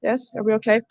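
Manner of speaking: ordinary talk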